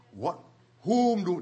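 ordinary talk, nothing marked

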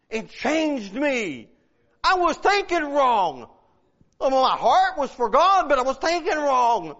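slow, emphatic testimony, drawn-out key words; forceful preaching. Acted. Paul tells why he is changed, forceful preaching.

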